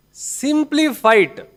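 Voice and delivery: commanding emphasis on simply fight; stress on simply. Acted. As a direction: lean hard and slow on simply. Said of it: "emphatic divine command".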